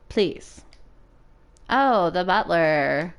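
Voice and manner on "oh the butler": ordinary talk, nothing marked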